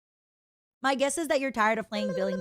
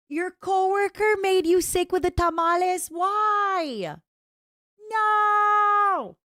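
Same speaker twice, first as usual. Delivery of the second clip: squeaky, much higher; high-pitched shocked voice; 10 semitones higher, 4 dB louder, slower than usual. Acted. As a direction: shriek the no in shock. Reacting to chat, high voice.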